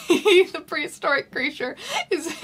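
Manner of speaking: breaking into high laughter mid-sentence; can't get through the line for laughing; giggling through the narration. Real, not acted.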